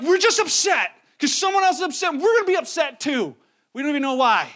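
mock the rioters who don't know why; clueless angry mob.

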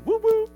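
high, loud "woo woo" cheer; two high celebratory hoots; party whoop. Real, not acted. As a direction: whoop it up for a holiday. Mock cheer for Saint Patrick's Day.

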